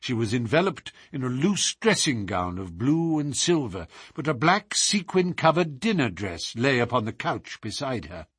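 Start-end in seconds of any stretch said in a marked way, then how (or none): none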